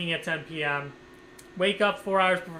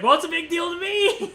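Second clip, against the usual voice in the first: indignant retort; 11 semitones higher, 5 dB louder than usual. Retold or imitated. Retorting to dismissive staff.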